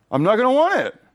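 a sudden high, loud outburst of refusal; mock defiant yell. Preacher acting out his stubborn flesh, yelling high and loud. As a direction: leap up high and loud in protest. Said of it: "what's gonna happen?".

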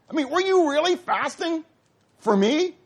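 high, stretched were you really fasting; emphatic challenge. Shown as a demonstration. God repeating his question to the people, emphatic. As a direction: press the question, pitch up high. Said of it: "It's emphatic".

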